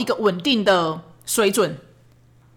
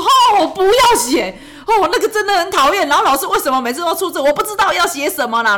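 put-on high, louder whining child voice; whiny child; 8 semitones higher, 4 dB louder than usual. Son whining to mom that he refuses to write his diary. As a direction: whine like a kid who hates homework.

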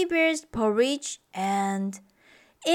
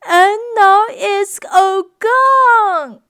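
much higher and louder, slow little-bear wail; a small bear cub, high and loud, slow and plaintive; wailing Baby Bear; 9 semitones higher, 9 dB louder, slower than usual. Acted. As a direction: whine it like a tiny upset cub.

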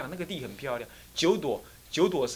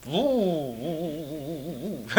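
mock engine drone; 3 dB quieter, much slower than usual. Imitating engine revving.

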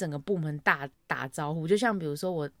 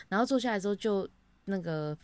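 ordinary delivery

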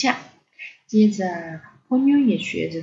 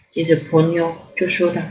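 ordinary delivery, nothing marked

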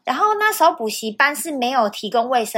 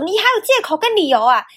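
higher, louder sneering teacher voice; mocking teacher sneer; 8 semitones higher, 4 dB louder than usual. Imitating the teacher who mocks every excuse, sneering. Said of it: "他就会说".

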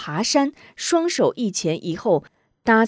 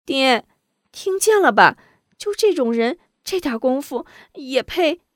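high mocking jab; disdainful young lady; scornful daughter; 7 semitones higher than usual. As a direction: sneer at the cousin to father. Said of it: "瞥了张雄一眼".

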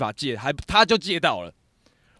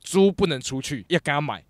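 ordinary delivery

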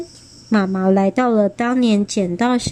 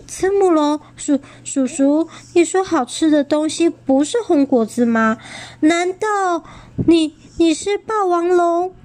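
put-on frightened child; 7 semitones higher than usual. A narrator's reading. Child realizing the danger.

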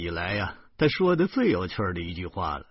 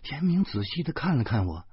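ordinary delivery

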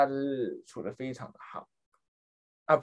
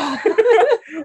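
high-pitched burst of laughter; a loud squealing laugh of disbelief; shrieking laugh; 9 dB louder than usual. Real, not acted. Telling her cohost about the moldy tub, a shrieking laugh. Recalling the filthy bathtub.

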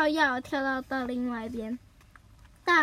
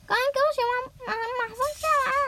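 much higher, fast nagging mom voice; scolding mother; 11 semitones higher, much faster than usual. Acted. Mother scolding Nobita for not doing chores, high and fast. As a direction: rattle off the scolding like a cross mom.

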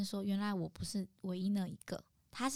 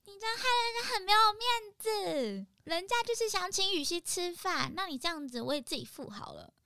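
much higher, loud indignant scolding; shrill scolding; 11 semitones higher, 3 dB louder than usual. Retold or imitated. Friend scolding the godfather for embarrassing her, high and loud. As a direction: scold him high and loud, playing offended. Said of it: "跟他这边大吵".

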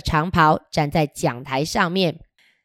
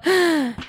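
gasp; 9 semitones higher than usual. Pretend. Wolf appears.

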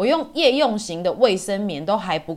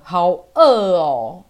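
slow, louder whine of hunger; whiny drawn-out; 7 dB louder, much slower than usual. Retold or imitated. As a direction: stretch it out like you're starving. Recalling hunger on the diet.

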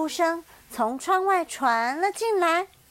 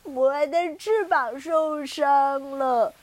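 slow, louder sobbing; crying; 4 semitones higher, 6 dB louder, slower than usual. Acted. Second penguin cries about its hurt wing. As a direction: wail through the words. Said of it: "第二只小企鹅哭着说".

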